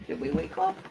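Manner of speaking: tiny, high, soft pet voice; high cooing. Real, not acted. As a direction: coo it up high to a puppy. Repeats question in cooing voice.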